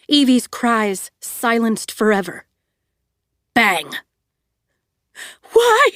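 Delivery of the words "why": plain reading voice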